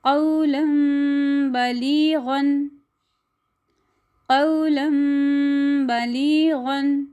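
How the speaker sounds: कौलन बलीगन with न turned to nasal म, drawn out; slow recitation. Shown as a demonstration. Tajweed examples. (धीमी तजवीद क़िराअत)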